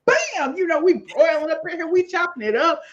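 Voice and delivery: high, animated kitchen play-by-play; excited re-enactment. Retold or imitated. Cook showing off how they broiled and chopped, excitedly. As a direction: relive the cooking with a high, lively burst.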